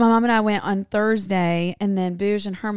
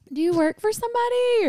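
much higher mock mom question; mom's voice; 12 semitones higher than usual. Daughter voicing her mom asking the biker. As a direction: lift the voice up to play her mom.

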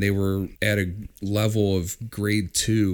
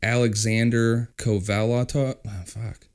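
normal talking voice

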